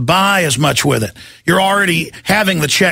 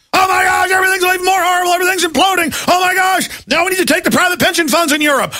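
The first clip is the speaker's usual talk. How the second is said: mock banker screaming for pension funds; hammed-up panicked banker; 10 semitones higher, faster than usual. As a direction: squeal it like a banker whose world is imploding. Mocking bankers begging for bailouts.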